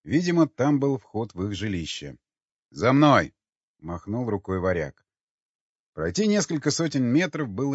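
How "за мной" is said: короткий громкий приказ; позови отряд за собой; командный оклик. Acted. (громкая команда)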